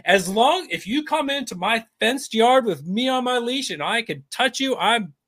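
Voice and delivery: a mild mock of empty tough talk; a touch of a yappy chihuahua posturing; mocking Biden. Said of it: "posture there and say".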